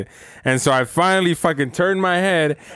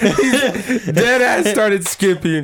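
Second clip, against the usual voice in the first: much higher, very slow, laughing; 8 semitones higher, much slower than usual. Real, not acted. Teasing his friend about skipping, laughing hard. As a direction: say it while cracking up.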